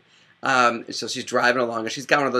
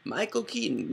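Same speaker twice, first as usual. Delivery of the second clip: MICHAEL KEATON pitched much higher and softer; different clone voice; 10 semitones higher, 5 dB quieter than usual. Acted. Listing the actor's clones, "Michael Keaton" high and soft. Same name for several clones.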